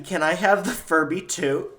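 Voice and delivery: put-on a kid asking her aunt sweetly; child asking politely. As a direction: ask like an eager little girl. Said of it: "Aunt Becky".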